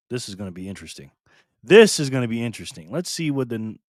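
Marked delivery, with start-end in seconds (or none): 0.1-1.1 quiet, low, quick aside. Real, not acted. First take, muttered before repeat. 1.6-2.9 louder announcement, stress on THIS; emphatic repeat. Real, not acted. Repeats it up for the audience.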